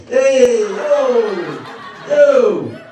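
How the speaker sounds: loud Brooklyn dad yelling hey yo; shouted Brooklyn hey yo yo. Retold or imitated. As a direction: bark out hey yo like a tough Brooklyn guy. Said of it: "good at spelling, especially vowels".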